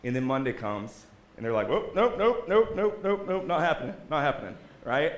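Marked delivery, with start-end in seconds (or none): 1.7-4.6 high, loud rapid-fire mock refusal; comic, alarmed backing away; panicked refusal. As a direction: play someone backing out in a hurry. Mimicking people who back out.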